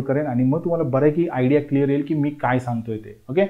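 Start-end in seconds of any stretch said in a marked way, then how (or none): none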